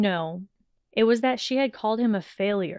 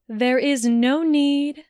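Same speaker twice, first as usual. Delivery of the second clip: higher, louder, slow insistence; emphatic headmistress reassurance; insistent; 6 semitones higher, 6 dB louder, slower than usual. A narrator's reading. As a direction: firmly refuse the apology, stretching each word. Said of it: "she insisted".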